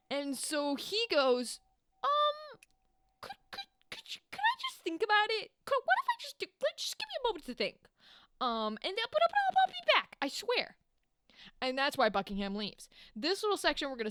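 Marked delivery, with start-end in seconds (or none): 2.0-10.8 much higher, stammering and rushing; a panicked man stammering for time; nervous stalling. Retold or imitated. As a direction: Buckingham stalling the king in a squeaky, flustered put-on voice.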